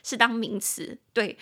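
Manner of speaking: ordinary talk, nothing marked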